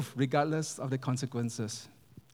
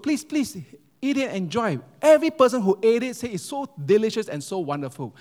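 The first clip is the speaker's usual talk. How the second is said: higher, pleading hostess; eager urging; 6 semitones higher, 5 dB louder than usual. Retold or imitated. Hostess serving her famous gumbo soup, eagerly urging. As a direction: press the guest proudly to taste it. Proud cook urging guest to eat.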